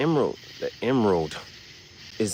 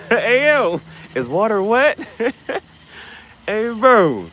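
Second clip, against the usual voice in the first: laughing hype; 13 semitones higher, 7 dB louder, slower than usual. Real, not acted. Laughing hype at water-wet question.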